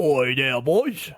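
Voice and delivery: hammed-up Cockney hail; mock Cockney. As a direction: call out as a cartoon British geezer. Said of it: "Cockney McCockingballs".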